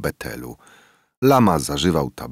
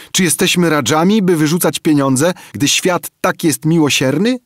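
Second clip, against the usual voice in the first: scoffing; 13 semitones higher, 6 dB louder than usual. A narrator's reading. Kim hushes lama, mocking.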